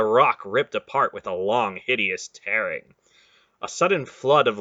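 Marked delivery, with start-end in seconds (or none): none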